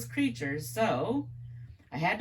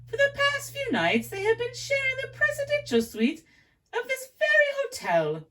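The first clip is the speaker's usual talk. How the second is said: put-on much higher, gossipy British accent; English accent; 8 semitones higher than usual. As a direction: dish the gossip in a high English voice. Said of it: "my accent's horrible".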